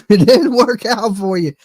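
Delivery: loud taunt through a laugh. Real, not acted. Streamer teasing rival over failed act, laughing. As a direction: laugh as you rub it in.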